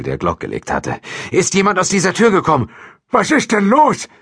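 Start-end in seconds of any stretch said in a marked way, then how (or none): 1.3-2.7 raised, high-pitched question; loud, urgent demand; urgent call. A narrator's reading. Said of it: "rief Franziskowitsch". 3.1-4.1 high, loud startled question; loud, high-pitched alarm; alarmed old janitor. A narrator's reading. Startled janitor answers the armed officer.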